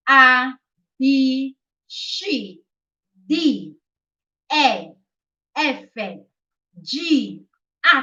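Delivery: Italian letter names, paused between for learners; Italian alphabet. Shown as a demonstration. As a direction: read "A" to "H" as Italian letter names: ah, bee, chee, dee, eh, effe, jee, acca. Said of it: "reading Italian alphabet".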